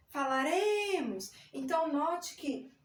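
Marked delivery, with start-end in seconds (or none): 0.1-1.3 "falaremos" with a long, sustained RE; stress on RE. Shown as a demonstration. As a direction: lean on the RE in "falaremos". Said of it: "a sílaba tônica está no re".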